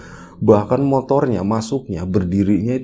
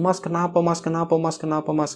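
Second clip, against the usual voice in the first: worried villagers; 8 semitones higher than usual. Retold or imitated. Villagers ask what happened.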